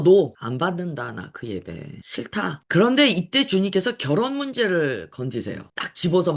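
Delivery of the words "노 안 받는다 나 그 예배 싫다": lightly voiced divine refusal, sinking low and slow; firm refusal that drops to a slow, low close; God refusing. Preacher voicing God rejecting false worship, lightly acted stern refusal.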